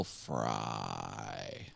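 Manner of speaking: low, slow creaky fry; vocal fry. Shown as a demonstration. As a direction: drag it out in deep vocal fry. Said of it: "when it talks like this".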